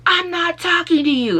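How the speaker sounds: mock rude cop, high and loud; angry officer snapping. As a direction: snap at her like a bullying cop. Officer snapping at crying wife.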